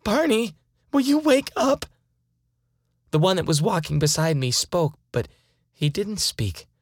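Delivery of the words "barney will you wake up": a frightened yell across the woods. Acted. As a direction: shout his name, desperate. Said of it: "I yell out".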